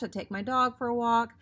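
ordinary delivery, nothing marked

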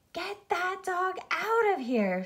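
high, stretched-out shout at no great volume; narrated shout. A narrator's reading. Arthur ordering the soap-eating dog out, storybook shout. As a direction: draw out each word in high-pitched outrage. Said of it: "shouted Arthur".

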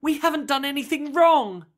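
high, loud protest; desperate child's wail of protest; begging. A narrator's reading. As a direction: plead innocence at the top of the voice. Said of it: "begged Tizzy".

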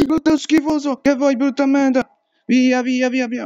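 high, loud insults; furious shouting; angry yelling. Real, not acted. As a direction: yell at the monster. Yelling at monster.

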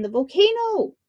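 high alarmed shout. A narrator's reading. Punia yelling again to wake the shark king, high shout. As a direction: call it out like a false alarm. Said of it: "he shouted again".

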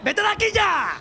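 high-pitched yell to drive someone off; exasperated high shout; urgent shout. Real, not acted. Yelling at the game opponent.

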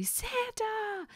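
high excited squeal of a name; high, gleeful cry of Santa; enthusiastic scream. Retold or imitated. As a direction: squeal it like an overjoyed kid. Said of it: "Buddy screams very enthusiastically".

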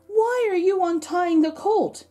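high, loud challenge; questioning owners. A narrator's reading. The colt's owners ask the men untying it, questioning. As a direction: pitch the question way up, loudly.

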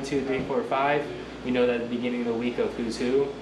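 ordinary delivery

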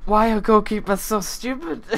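high, loud exasperation; exasperated rant. Real, not acted. Gamer ranting at his goalkeeper, high exasperated. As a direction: rant at the keeper. Keeper error in game.

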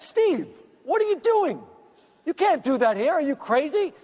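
mock alarm at him; shocked scolding. Scolding Steve in lobby.

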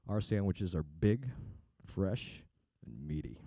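low, slow, softening sexy ad voice; sultry ad read. Retold or imitated. Voiceover guy reading a sandwich ad, low and sultry. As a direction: purr it like a steamy commercial. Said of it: "trying to make me sound creepy".

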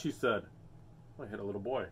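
usual speaking voice

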